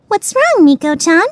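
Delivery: ordinary talk, nothing marked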